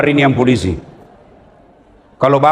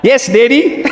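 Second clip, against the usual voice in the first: mock kid reply; 9 semitones higher, slower than usual. Mocking modern kid's reply.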